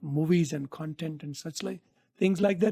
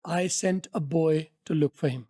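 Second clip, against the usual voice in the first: put-on I sent a boy to look for him drawn way out; slow it right down to teach; very slow model line; much slower than usual. Shown as a demonstration. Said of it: "repeats line slowly".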